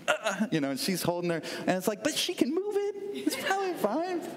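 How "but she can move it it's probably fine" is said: high, airy imitation of a kid shrugging it off; mock daughter voice. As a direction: play the carefree daughter, pitched up. Daughter retold brushing off injury.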